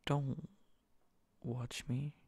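normal talking voice

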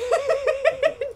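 rapid high-pitched cackle; loud burst of high, pulsing laughter; high giggle. Real, not acted. Player asked about R T, high giggle.

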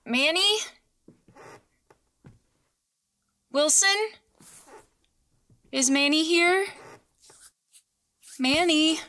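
high anxious calls through the house; calling out. Acted. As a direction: call out, searching room to room.

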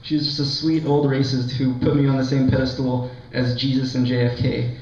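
ordinary talk, nothing marked

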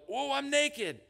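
sudden high, loud gasp of discovery; startled realization. Pretend. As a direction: catch yourself naked in shock.

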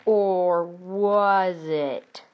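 very slow, stretched-out words; suspenseful twist. Acted. Storyteller casts doubt on the dream, slow suspense. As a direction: drag it out to tease a twist.

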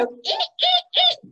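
very high, piercing little eeks; bat squeaks. Retold or imitated. Bats make noise in the house, high squeaks. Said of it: "the bats would go".

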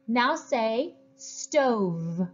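slow phonics prompt to second graders; slow, clear teacher prompt. Shown as a demonstration. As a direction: say stove very slowly. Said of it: "say another word".

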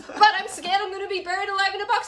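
high, loud mock scared plea; mock fearful plea. Patient pleading with the therapist, high and loud mock fear. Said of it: "And she goes".

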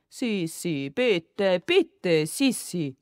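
drawn-out, wheedling plea; coaxing. A narrator's reading. Father and brother beg Sissi to tell, coaxing. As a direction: stretch each word to cajole. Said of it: "Der Vater und Sigi schmeichelten".

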